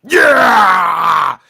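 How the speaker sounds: long, loud hyped shout; wrestler's entrance yell. Acted. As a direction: bellow one drawn-out entrance cry. Guest's entrance yell after introduction.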